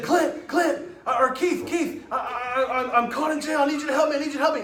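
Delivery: high, loud, stammering mock panic; frantic pleading. Crazy lady phoning prankster from jail, frantic pleading. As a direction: imitate a desperate caller begging for help.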